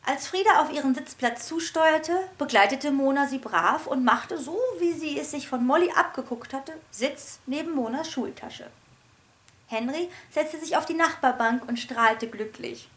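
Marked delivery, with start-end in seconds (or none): none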